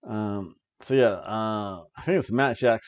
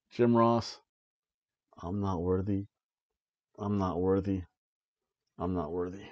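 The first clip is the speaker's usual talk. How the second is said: mock worship; 5 semitones lower, 7 dB quieter than usual. Bowing to Jim Ross.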